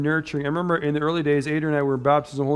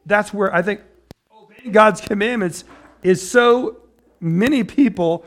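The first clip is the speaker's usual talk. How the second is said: builds louder and higher; preacher swelling to a peak; rising preaching; 5 semitones higher, 6 dB louder than usual. Real, not acted. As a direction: climb into full preaching fire.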